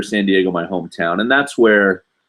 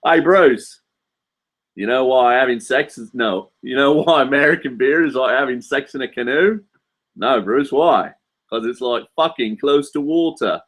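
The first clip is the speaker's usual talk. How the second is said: higher-pitched Aussie joke-telling; Australian accent; 5 semitones higher than usual. Acted. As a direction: act out the sketch as an Australian. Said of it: "not bad for my Australian".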